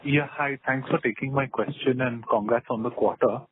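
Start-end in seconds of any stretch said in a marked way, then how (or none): none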